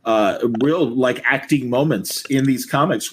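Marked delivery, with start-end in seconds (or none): none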